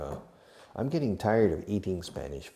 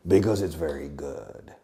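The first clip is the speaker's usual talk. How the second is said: 1 put-on answering voice; 5 semitones lower than usual. Mock answer in other voice.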